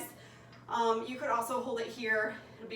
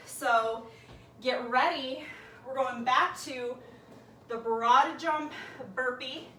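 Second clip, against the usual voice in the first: usual speaking voice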